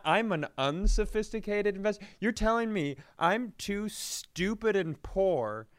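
normal talking voice

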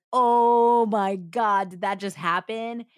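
imitated amazement, long drawn-out opening; stunned excitement. Detectives in the van hearing the confession, stunned excitement. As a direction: stretch out the opening in mock disbelief. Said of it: "losing their minds".

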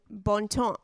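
attempted French pronunciation of "Bon Temps"; French accent. Shown as a demonstration. Host trying out the tea brand's name, French accent. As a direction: say "Bon Temps" the French way, silent final consonants. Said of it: "try to say it in a French accent".

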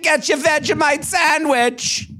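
high, slow mock-Aussie; bad Australian accent. Joking as the Australian chick, bad Australian accent. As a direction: ham up a fake Australian accent. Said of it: "shitty Australian accent".

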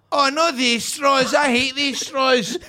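slow, drawn-out mock moan; mock whining. Guest grumbling about paper straws, mock whining. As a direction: complain like a fussy wedding guest.

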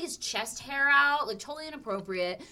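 usual speaking voice

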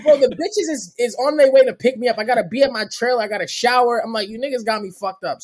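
high, loud re-enacted complaint; indignant rant. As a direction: rant at the guards, mock outraged.

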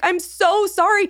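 high, drawn-out pleading apology; flustered apology. Retold or imitated. Patient apologizing to nurse for missing check-in, flustered.

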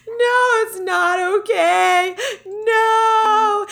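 high tearful pleading; crying child. Retold or imitated. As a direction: plead through tears, high. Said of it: "And I start crying".

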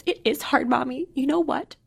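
lightly imitated small child, pitched high; upset little kid. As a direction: mimic a little girl confiding a grievance. Voicing upset four-year-old daughter.

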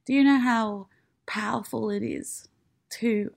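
usual speaking voice

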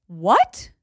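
high, gasped disbelief; gasp, then question it way up high; gasping shock. Real, not acted. Co-host reacting to comment on her top, gasping shock.

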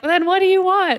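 very high, mock-exasperated outburst; comic frustration, pitch shooting up; exasperated parent. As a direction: play a parent at the end of their rope. Acted exasperated parent answering tantrum.

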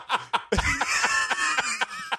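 squealing burst of laughter; a real, high squealing laugh; high shrieking laugh. Real, not acted. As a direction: let out a high shrieking cackle. Laughing after a rant.

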